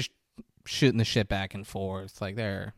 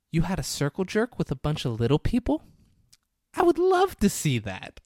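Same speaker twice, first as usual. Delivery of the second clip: higher, eager, turned-on delight; excited; 7 semitones higher, 3 dB louder than usual. Retold or imitated. Woman telling Steven she'd love to see it, excitedly. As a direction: say it like she's thrilled by the idea. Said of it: "very genuinely looked, like, turned on".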